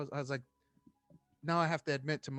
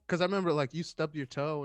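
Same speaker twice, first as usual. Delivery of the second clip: usual speaking voice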